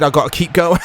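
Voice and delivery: ordinary delivery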